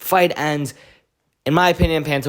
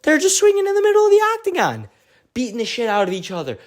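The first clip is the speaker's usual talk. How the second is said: high, fast frenzied retelling; worked-up fight recap, pitched way up; excited recounting; 13 semitones higher, faster than usual. Retold or imitated. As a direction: rush it out high as the brawl unfolds.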